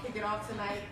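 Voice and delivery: ordinary talk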